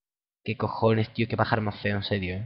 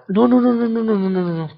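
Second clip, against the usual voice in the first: panicked no; 9 semitones higher, 5 dB louder than usual. Real, not acted. Panics as fight goes wrong.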